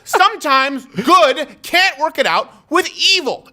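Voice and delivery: high-pitched, slowed, emphatic comic indignation; outraged rant. Real, not acted. Host preaching good versus evil over stolen pens, high-pitched indignant rant. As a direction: drive each phrase home in a strained high voice.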